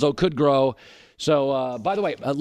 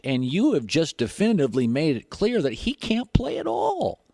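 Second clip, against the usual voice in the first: usual speaking voice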